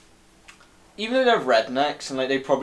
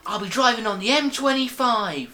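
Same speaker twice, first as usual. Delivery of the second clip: high, loud fake London cabbie accent; hammed-up London accent, pitched well up; bad London accent; 10 semitones higher, 4 dB louder than usual. London cabbie offering a ride to Heathrow, bad London accent. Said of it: "bad accents".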